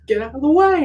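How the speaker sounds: comic high-pitched yell at traffic; mock yell. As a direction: bark it high and loud at the street. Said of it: "he goes".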